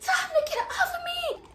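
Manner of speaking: very high-pitched acted shriek; squealed mock plea; yelling for help. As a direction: holler to his sister for rescue. Pinned, calling sister for help.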